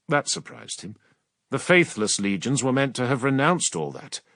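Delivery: usual speaking voice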